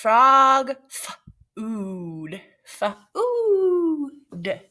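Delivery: drawn-out, sung title; dramatic title read. Acted. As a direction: announce it grandly.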